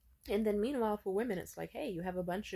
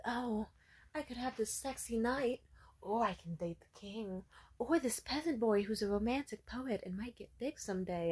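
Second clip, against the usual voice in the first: lightly voiced dreamy teen girl, pitched a bit higher; swooning storybook girl; 4 semitones higher than usual. Teen-novel heroine weighing her suitors, lightly dreamy voice. Girl daydreams about romance options.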